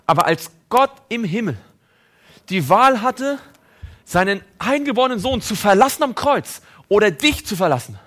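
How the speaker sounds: rising pulpit fervour; impassioned preaching. Acted. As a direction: build the climax, nearly shouting. Preacher builds to climax.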